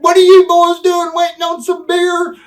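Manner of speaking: high, loud, hammed-up hail; Vader joking. Vader teasing the boys waiting for beer, high loud hammed-up greeting. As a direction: tease them like an old buddy. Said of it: "He said".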